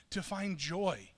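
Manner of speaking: ordinary delivery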